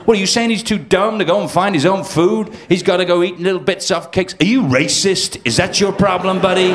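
a hint of an offended American; indignant heckler. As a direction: bristle back at the comic like an offended local.

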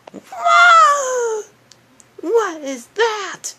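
high, loud startled whoa; cartoonish surprise, high and loud; startled gasp. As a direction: jump at something weird. Startled by something strange.

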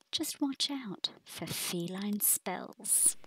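slow whispered warning; slow ominous whispered warning; spooky whisper. A narrator's reading. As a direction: creep them out softly.

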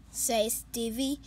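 usual speaking voice

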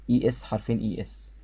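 ordinary delivery

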